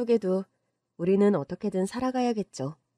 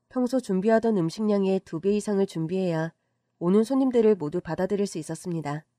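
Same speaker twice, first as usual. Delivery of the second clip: ordinary delivery, nothing marked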